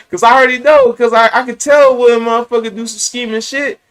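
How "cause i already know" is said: amused laugh at catching a shoplifter; real laugh. Real, not acted. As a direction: burst of high laughter, then words still laughing.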